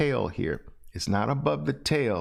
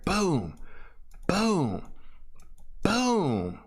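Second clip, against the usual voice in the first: rising, punchy booms called out; excited boom calls; 6 semitones higher, much slower than usual. Shown as a demonstration. Trading teacher calling out entries, punchy and rising. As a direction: call each entry with a bang. Trader calling out each entry.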